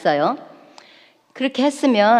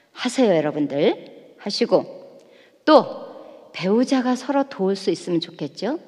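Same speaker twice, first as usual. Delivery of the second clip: normal talking voice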